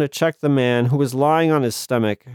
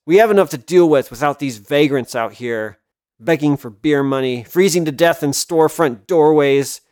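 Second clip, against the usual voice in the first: through clenched teeth, loud; seething, building to a loud rant; shaking with anger; 4 dB louder than usual. Acted. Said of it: "through clenched teeth".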